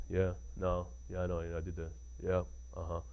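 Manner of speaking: low, soft mumbled brush-off. Retold or imitated. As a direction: mumble like you don't care about the question. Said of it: "he'll talk like this".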